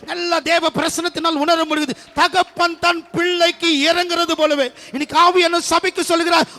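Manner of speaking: loud, high fervent preaching; full-force preaching. Acted. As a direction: proclaim it to the whole church. (உரத்த குரலில் ஆவேசமான பிரசங்கம்)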